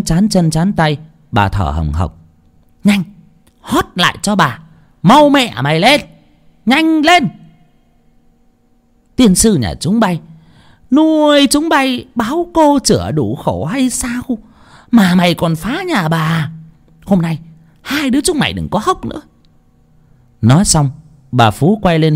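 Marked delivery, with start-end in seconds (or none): 2.8-7.3 high, sharp commands in short bursts; harsh, impatient mistress; angry ordering. A narrator's reading. Mistress barks orders after the beating. 9.2-19.2 high-pitched scolding tirade; railing, exasperated mistress; angry cursing. A narrator's reading. Mistress curses the two children.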